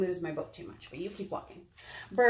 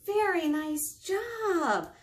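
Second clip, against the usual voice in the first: high, very drawn-out praise; sing-song congratulations; 8 semitones higher, much slower than usual. Real, not acted. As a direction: stretch out the compliment for little ones. Praising children after the story.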